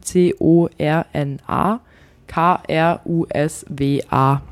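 slow letter by letter; spelling it out. Shown as a demonstration. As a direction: spell each letter, slowly. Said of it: "kannst du das mal buchstabieren".

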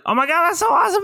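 put-on high-pitched, gushing child; excited kid. Voicing a kid given a coloring book, high excited gush. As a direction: squeal it like a thrilled kid. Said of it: "they're like".

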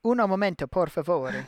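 jokey fake Italian accent; mock Italian. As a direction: say it like a hammy Italian waiter. Joking mock Italian aside.

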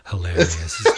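breathy whispered hilarious, half laughing; whispered deadpan. Retold or imitated.

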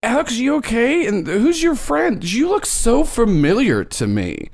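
high-pitched, drawn-out questioning; high, slow, sugary probing voice; teacher character. Acted. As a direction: coo the questions slowly, up high. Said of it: "And she, she goes".

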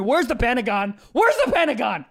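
loud, high comic outburst, building; mock frantic shouting. Comic threatens to take the plane down, mock frantic shouting.

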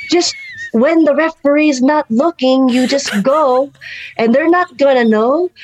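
slow imitation of a scheming auntie; old lady. Old mom advises cheating behind referee, impersonated. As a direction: coax like a crafty little grandma. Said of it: "she's an old lady".

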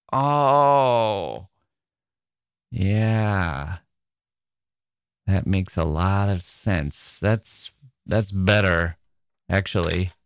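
drawn-out "oh", then low and slow; imitating a sheepish, gradual climb-down; dawning realization. Friend realising he misheard the phrase, slow dawning realization.

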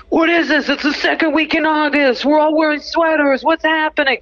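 high-pitched mock Boston local in a panic; hammed-up Boston worker, baffled and complaining; Boston accent. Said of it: "That's my Boston impression".

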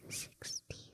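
breathy hushed whisper; barely a breath, unvoiced. Real, not acted. Whispered quiz answer.

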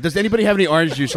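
retold yell. Imitating his friend's frantic request before going live, yelled. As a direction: call it out fast, like an emergency. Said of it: "he yells".

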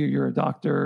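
normal talking voice

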